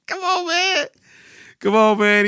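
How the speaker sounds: high laugh through come on man; cracking up and pleading; laughing protest. Real, not acted. Watching Charles flex out of the shower, laughing. Reacting to Charles's shirtless scene.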